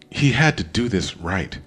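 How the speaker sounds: ordinary delivery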